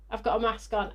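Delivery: usual speaking voice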